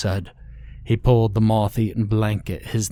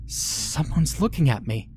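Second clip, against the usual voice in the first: higher, words struggling past a swollen tongue; 6 semitones higher than usual. A narrator's reading. Woman on the ground to her husband, swollen-tongued and struggling. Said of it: "struggling to get the words out around her swollen tongue".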